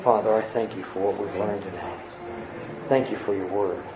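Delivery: low, soft, reverent prayer voice; closing prayer. Real, not acted. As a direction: pray quietly and humbly.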